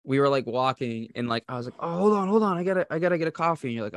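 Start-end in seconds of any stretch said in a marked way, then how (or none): 1.8-3.7 higher-pitched, hurried plea to wait; retold urgent interjection. Telling friends to wait for a coffee stop, urgent. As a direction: blurt it out, speeding up into the excuse.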